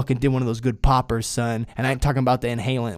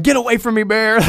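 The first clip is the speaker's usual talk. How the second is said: higher, louder mock yell to scare off a bear; retold yell at bear; 9 semitones higher, 7 dB louder than usual. Hiker retelling how he yelled to scare off a bear, loud mock yell. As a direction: shout it at the bear like you mean it, half joking.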